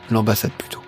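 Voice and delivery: ordinary talk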